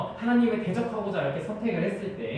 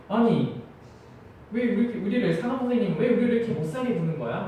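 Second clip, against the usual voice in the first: lightly voiced whiny protest, a bit higher; mock student complaint; 3 semitones higher than usual. Dorm students grumbling about the strict supervisor, a mild mock whine. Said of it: "불만을 토로하고".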